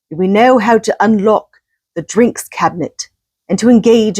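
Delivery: put-on English accent. Host quoting Johnson's UN speech in an English accent. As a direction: mimic a British prime minister in an English accent. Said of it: "I'm gonna try and do my English accent".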